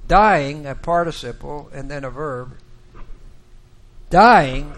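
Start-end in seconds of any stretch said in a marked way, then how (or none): 0.1-0.7 DYING hit hard and loud; emphatic. Shown as a demonstration. Teacher reading the Hebrew literally to a class, emphatic. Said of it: "It's very emphatic in Hebrew". 4.1-4.7 DYING struck much louder, then a pause; emphatic. Shown as a demonstration. Preacher showing the Hebrew emphasis on dying, emphatic. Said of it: "It's very emphatic in Hebrew".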